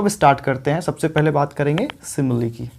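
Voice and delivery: usual speaking voice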